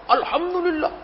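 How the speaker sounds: high, vigorous reply; hearty, fierce voice. Acted. Sick man answering a caller's greeting, sounding hearty and robust. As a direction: answer with gusto, pitched well up. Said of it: "suaranya gagah, garang".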